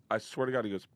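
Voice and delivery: ordinary delivery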